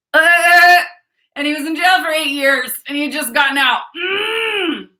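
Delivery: high, loud, cracking with tears; crying out. Real, not acted. Mother recalling the man who approached her son, crying out.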